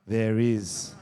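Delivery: low, mock-ominous reply; lightly voiced young nephew. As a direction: imitate a kid with a grave secret. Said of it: "He's like".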